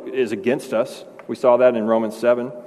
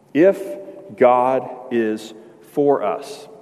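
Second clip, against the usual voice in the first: drawn out, each word weighed; a slow, weighty reading; much slower than usual. Acted. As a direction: let it hang, very slowly. Preacher lets key line sink in.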